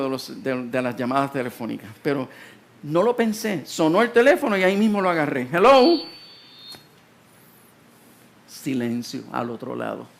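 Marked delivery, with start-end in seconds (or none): 5.5-6.0 high, loud, acted hello; loud phone greeting. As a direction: call it brightly into the receiver, louder and higher. Answering the phone in the retold story.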